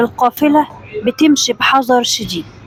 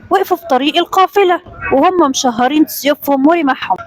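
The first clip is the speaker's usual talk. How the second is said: plain reading voice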